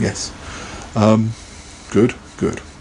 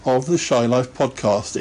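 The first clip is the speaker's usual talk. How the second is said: announcer; 5 semitones higher, much slower than usual. Acted. Host announcing episode over jingle.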